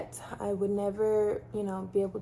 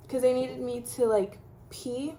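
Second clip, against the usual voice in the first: ordinary talk, nothing marked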